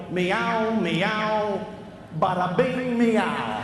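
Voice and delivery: loud, cocky New York cat meows; cat with New York attitude. Acted. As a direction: meow like a brash New Yorker. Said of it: "If cats could talk, they go".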